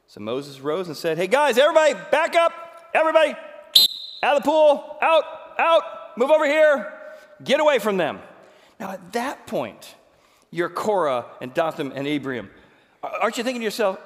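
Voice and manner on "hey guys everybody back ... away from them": loud, much higher urgent calls; calling out urgently. Acted. Moses to the congregation, urgent loud calling. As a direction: wave the crowd back, calling loudly.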